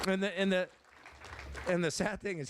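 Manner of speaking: on the edge of crying at a farewell speech; choked up. Real, not acted. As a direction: hold back tears as you speak. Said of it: "You can blubber up there".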